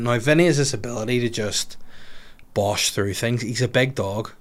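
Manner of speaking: ordinary talk, nothing marked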